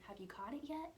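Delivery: ordinary delivery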